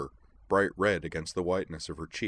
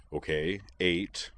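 conceding; slower than usual. A narrator's reading. Moose accepts Edward's corrected ticket count, conceding. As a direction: give in without fuss. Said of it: "Moose conceded".

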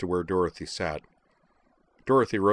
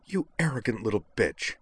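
angry, spat-out insult pitched up; snarled; 4 semitones higher than usual. A narrator's reading. Utena furiously insults the robot Dorothy, snarling. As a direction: snarl it with contempt. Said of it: "Utena snarled".

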